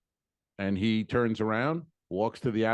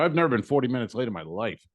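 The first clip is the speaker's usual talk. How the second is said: fast protest; faster than usual. Retold or imitated. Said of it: "I go".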